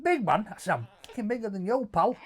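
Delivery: high, fast, riled-up comeback; squaring up to a waiter who mocked him, rising high and fast; angry retort. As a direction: bite back hard at the insult. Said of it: "My head went".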